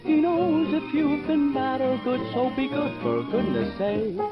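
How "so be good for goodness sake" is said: ordinary talk, nothing marked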